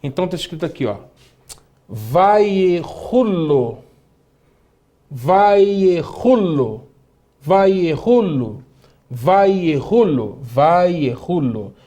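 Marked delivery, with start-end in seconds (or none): 1.9-11.7 very slow, drawn-out syllables; slow model reading. Shown as a demonstration. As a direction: stretch each syllable for learners to follow. Teacher slowly models a Hebrew word. (leitura bem lenta, sílabas alongadas)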